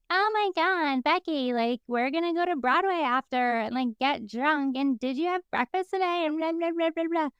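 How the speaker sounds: put-on high, excited party-girl chatter; drunk concert girls. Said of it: "And they're like".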